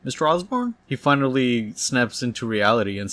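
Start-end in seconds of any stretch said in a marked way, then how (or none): 0.0-0.7 higher, questioning call with a rise; getting his attention. Retold or imitated. Assistant to zoned-out boss, prodding with a high rising question. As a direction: call "Mister Osborn" higher, lifting at the end. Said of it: "trying to get his attention by saying his name".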